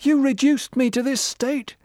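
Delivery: high, loud anguished sob; sobbing. Acted. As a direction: cry it out, broken and accusing. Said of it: "sobbed Winston".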